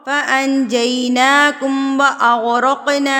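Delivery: slow chanted tajweed recitation; Quran recitation. Acted. Teacher recites the verse in slow tajweed chant. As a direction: recite it slow and melodic, drawing out vowels.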